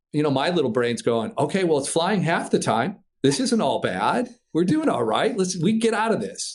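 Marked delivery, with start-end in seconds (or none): 1.4-6.5 rising higher and higher, talking yourself up; hopeful self-talk. Retold or imitated. Pilot's inner voice reassures himself mid-collapse, climbing higher. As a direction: pump yourself up against the odds. Inner voice hoping while falling.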